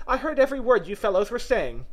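ordinary delivery, nothing marked

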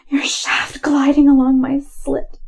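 plain reading voice

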